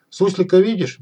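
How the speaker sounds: ordinary delivery, nothing marked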